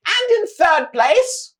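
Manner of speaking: high, loud, slow showman cry; grand announcer. Acted. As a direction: boom it out to the whole arena. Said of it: "He says".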